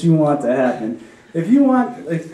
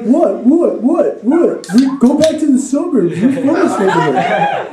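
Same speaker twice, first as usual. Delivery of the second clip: put-on high, cocky challenge from mouthy kids; mock teen taunting; 7 semitones higher, slower than usual. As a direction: imitate bratty kids squaring up. Kids taunt back in retold quarrel.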